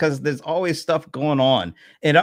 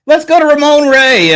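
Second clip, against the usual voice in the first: much higher and louder, announcer hype; hyped intro; 13 semitones higher, 10 dB louder than usual. Real, not acted. Host introducing guest Ramon Ray, hyped up. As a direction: throw to Ramon Ray with big energy.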